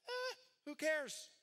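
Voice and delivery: high, drawn-out apathetic whine; mock indifference. Preacher mimicking bored listeners reading the passage, mock indifference. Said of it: "you're gonna be like".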